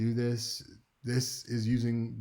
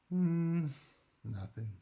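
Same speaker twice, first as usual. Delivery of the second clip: hinting something's wrong; 6 semitones higher, slower than usual. Acted. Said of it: "Maybe they say it like this".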